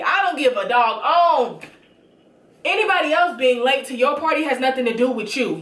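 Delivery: ordinary talk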